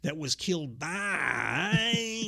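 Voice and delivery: last word dragged out very long; Savage stretching for time. Acted. Savage stalling mid-promo, stretched out. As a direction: draw out the final word like a stalling wrestler. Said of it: "definitely him stretching for time".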